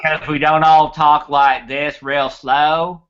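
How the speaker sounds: slow, exaggerated West Texas drawl; West Texas accent. Shown as a demonstration. Debunking a myth about how Texans talk, slow West Texas accent. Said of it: "That's West Texas".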